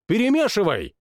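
high, imperious royal voice; a spoiled king barks an order; capricious king. Acted. King orders his cousin to shuffle, high and imperious. King's voice in story.

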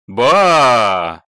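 long, loud mock-amazed vowel; drawn-out exclamation. A narrator's reading. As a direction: stretch it out with emphasis. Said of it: "протянул Миша".

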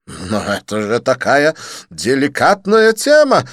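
slow, loud pleading protest. Acted. Didier protesting to Lin, slow loud plea. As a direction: protest that it was delicate. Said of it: "запротестовал Дидье".

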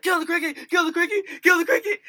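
high, frantic repeated plea; panicked yelling. Retold or imitated. Max on his bed begging his roommate, high panicked yell. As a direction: imitate a roommate shrieking orders from the bed.

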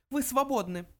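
high-pitched cold dismissal. A narrator's reading. Mouse sends the old man away, cold. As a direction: dismiss him coldly in a high little voice. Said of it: "холодно отрезал Бенджи".

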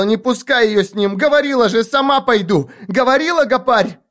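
put-on high, frantic, accusing girl; hysterical screaming. A narrator's reading. Girl struggling in restraining arms to the gang leader, hysterical screaming. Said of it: "зашлась в крике бьющаяся".

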